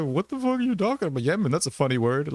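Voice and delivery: quick high clueless voice; baffled normie. Retold or imitated. Friend asked about Yemen, answering in a high baffled rush. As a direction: play the friend who never heard of Yemen. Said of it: "And they're like".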